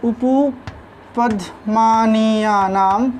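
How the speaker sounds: very slow, drawn-out उपपदमानीयानाम with pauses inside; syllable by syllable. Shown as a demonstration. Teacher reciting the rule for lip sounds to students, slowly in separated syllables. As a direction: stretch उपपदमानीयानाम out piece by piece.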